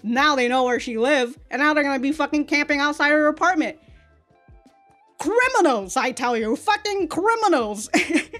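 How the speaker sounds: ordinary talk, nothing marked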